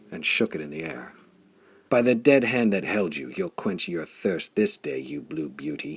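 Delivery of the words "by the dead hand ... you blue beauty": ordinary delivery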